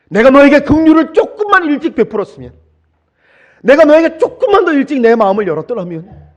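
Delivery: high, loud anguished cry of regret; loud grieving king's cry; David's lament. Acted. David's regret after son's death.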